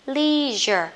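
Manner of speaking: LEISURE with long EE, "LEE-zher"; American leisure. Shown as a demonstration. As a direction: stretch the EE in LEISURE. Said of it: "Người Mỹ á thì thích đọc". (đọc LEISURE kiểu Mỹ, "li-giơ")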